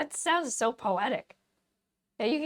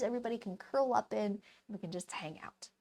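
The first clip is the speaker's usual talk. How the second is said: whisper; 2 semitones lower, 7 dB quieter, faster than usual. Real, not acted. Cozy invite to hang out.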